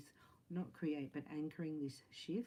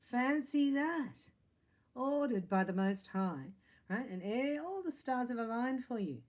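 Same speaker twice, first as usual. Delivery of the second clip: excited; 8 semitones higher, 7 dB louder than usual. Real, not acted. Reader delighting in the viewer's new love, excited. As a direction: announce it like wonderful news.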